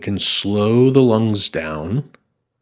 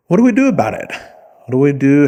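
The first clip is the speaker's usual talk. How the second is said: mock alarm; 7 semitones higher, 6 dB louder, faster than usual. Rhetorical question to audience.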